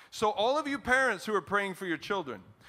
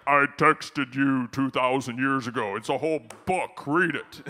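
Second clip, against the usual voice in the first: booming, emphatic mock-divine voice; God; 4 semitones lower, 8 dB louder than usual. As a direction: thunder it out as an exasperated almighty. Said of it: "And God's like".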